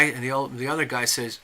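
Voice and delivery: ordinary delivery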